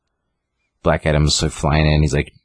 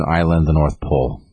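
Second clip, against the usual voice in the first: ordinary delivery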